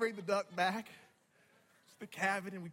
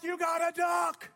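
loud, higher, stretched-out group cheer; 9 semitones higher, 6 dB louder, slower than usual. Retold or imitated. Fellow hunters celebrating his first duck, cheering. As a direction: shout it like a celebrating hunting party. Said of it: "all of them were cheering".

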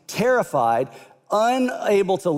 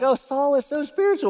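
mock pious gushing; 9 semitones higher than usual. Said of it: "we're like".

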